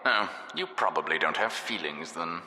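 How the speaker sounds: usual speaking voice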